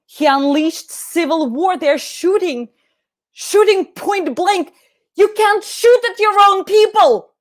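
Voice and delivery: high, drawn-out cry of horror; horrified outcry. Acted. Witness sees police shooting point blank, horrified outcry. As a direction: climb to an appalled, pleading shout.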